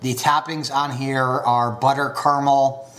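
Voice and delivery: ordinary talk, nothing marked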